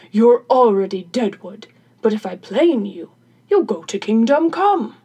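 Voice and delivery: loud, put-on workman's voice; protesting carpenter. Carpenter warns crone planing kills, loud protesting voice. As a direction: warn her loudly in character.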